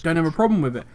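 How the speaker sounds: ordinary delivery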